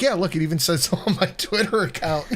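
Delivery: fast, giggling brag; laughing boast. Retold or imitated. Telling a friend about a fake doctorate, laughing. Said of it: "I'm like".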